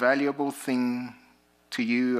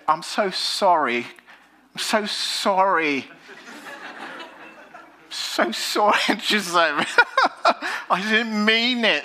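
higher, laughing, over-polite British apology; exaggerated British accent; 10 semitones higher, 4 dB louder than usual. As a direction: ham up a British accent to charm the cop. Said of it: "intensify my British accent".

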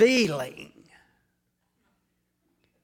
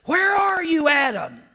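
calling out; 8 semitones higher, 5 dB louder than usual. Acted. God calling out to Adam.

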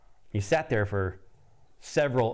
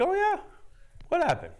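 curious friend. Retold or imitated. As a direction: ask it in a bright falsetto, eager to hear. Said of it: "He's like".

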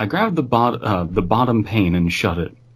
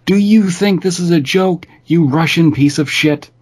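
high, loud furious scream; screamed in rage; 9 semitones higher, 4 dB louder than usual. Retold or imitated. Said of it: "flew into a rage and screamed".

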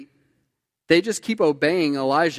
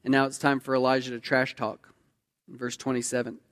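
usual speaking voice

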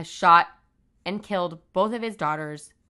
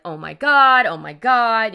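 raised, louder pleas at a storyteller's remove; retold freaking out; 4 semitones higher, 10 dB louder, slower than usual. Retelling the wounded mother's call, imitated panic. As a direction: imitate someone panicking, loud and higher. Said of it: "you know, freaking out".

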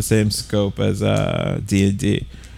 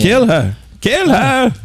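higher, urging kill call; urgent; 13 semitones higher, 3 dB louder, much slower than usual. Real, not acted. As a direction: yell for the kill, rising. Calling out in game.

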